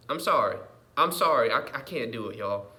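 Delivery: usual speaking voice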